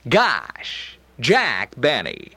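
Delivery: slow, exasperated Benny "Gosh"; drawn-out put-upon gosh; Jack Benny impression. Shown as a demonstration. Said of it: "my door to Jack is probably the word gosh".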